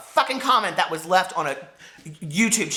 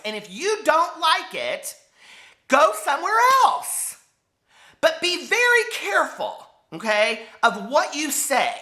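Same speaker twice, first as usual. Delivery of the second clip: real anger; 8 semitones higher than usual. Real, not acted. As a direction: make every word land, raised and hurt. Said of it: "this kind of anger".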